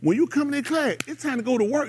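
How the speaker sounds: high, scolding teacher. Retold or imitated. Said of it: "I had teachers".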